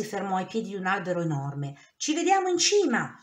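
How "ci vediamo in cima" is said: high, louder call as he dashes off; shouted. A narrator's reading. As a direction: yell it over your shoulder. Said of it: "gridò".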